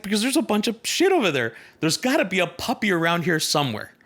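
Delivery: put-on high, eager voice of a thrilled child; bubbling over with glee; excited optimist kid. Optimist boy answering his puzzled parents, excited. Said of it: "He's like".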